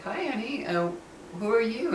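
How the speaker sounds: put-on lightly sweeten it for a child; sweet greeting to a child. Greeting a little neighbor girl.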